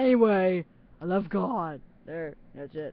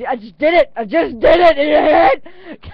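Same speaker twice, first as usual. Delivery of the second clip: rising, louder squeal of excitement; excited outburst; 6 semitones higher, 11 dB louder than usual. Retold or imitated. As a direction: blurt it out, thrilled you caught yourself. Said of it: "We're both like".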